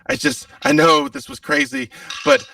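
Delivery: talking fast to save his life; desperate pleading. Acted. Captive pleading before being shot.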